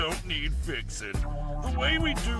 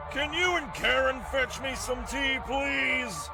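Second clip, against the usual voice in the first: high, sugary request through a smile; falsely sweet boss's order; smiling but mad; 7 semitones higher than usual. Acted. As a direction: hide fury under a pleasant ask. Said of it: "He may be smiling, but I know he's super mad".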